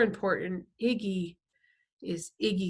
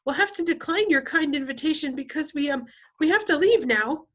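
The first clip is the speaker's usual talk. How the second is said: high, loud, nervous politeness; chattery high; 8 semitones higher, 7 dB louder than usual. A narrator's reading. Freak turning down the gang boss's invitation, chattery high. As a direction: decline politely in an anxious high voice. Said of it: "his voice is chattery high".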